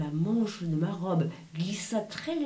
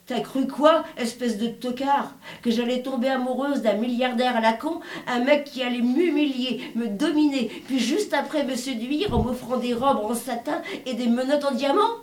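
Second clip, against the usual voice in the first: louder, higher and fast, spitting contempt; furious outburst; 5 semitones higher, 5 dB louder than usual. Acted. Heroine raging over the choking billionaire, furious shout. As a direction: let the rage explode at him.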